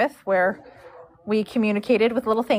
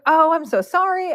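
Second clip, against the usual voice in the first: higher-pitched mock apology; apologetic; 7 semitones higher than usual. Horse owner apologizing to the braider, apologetic. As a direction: imitate a flustered apology, pitched up.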